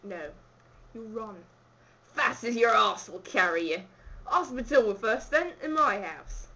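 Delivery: put-on Cockney accent. Host sending visitor off running, Cockney accent. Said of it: "I'll do a Cockney accent".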